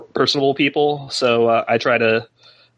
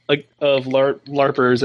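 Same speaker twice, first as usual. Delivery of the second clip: usual speaking voice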